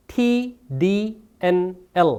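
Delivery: slow, separate "T D N L"; tongue-tip consonant drill. Shown as a demonstration. Teacher drilling four sounds for students, slowly and distinctly. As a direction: tap out "T D N L" one by one, tongue on the ridge. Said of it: "계속 때려줘야 됩니다".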